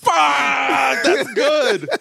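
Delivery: high, loud cry through real laughter; cracking up. Real, not acted. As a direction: shout approval while helpless with laughter. Cracking up at joke.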